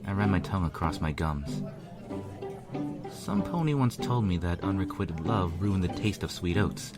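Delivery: ordinary delivery, nothing marked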